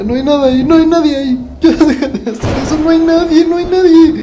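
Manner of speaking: cling to denial in rising panic; panicky. Real, not acted. Vlogger panicking as the dog stares, high nervous laughter.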